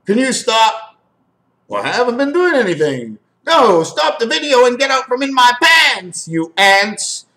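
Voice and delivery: high put-on voice in mock protest; hammed-up pleading, swooping way up in pitch; exasperated comic character. Acts out skit with the ants.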